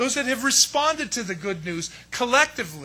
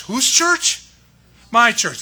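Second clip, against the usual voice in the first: emphatic; 3 semitones higher, 8 dB louder, slower than usual. Acted. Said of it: "pastor stressing ownership".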